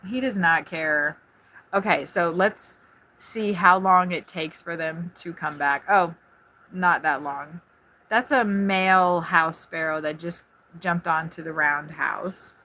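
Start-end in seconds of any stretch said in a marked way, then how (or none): none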